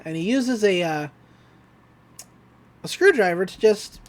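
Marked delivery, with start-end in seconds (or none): none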